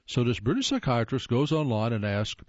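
normal talking voice

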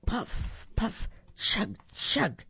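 breathy high puff-puff chug-chug; puffing engine. A narrator's reading. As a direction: huff it out like a little steam engine. Train engine noise in story.

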